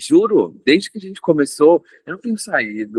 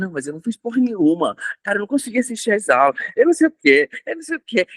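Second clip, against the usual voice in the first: panicked self-talk; 7 semitones higher, much faster than usual. Retold or imitated. Retold panic about unfinished classes.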